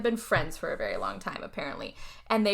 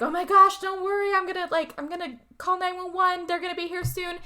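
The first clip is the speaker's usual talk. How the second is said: much higher, rushed and frantic comfort; panicked reassurance; 10 semitones higher than usual. Retold or imitated. Sarah kneeling by her bitten neighbor, high frantic reassurance. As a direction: pitch up high and promise help in a flurry. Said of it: "is like".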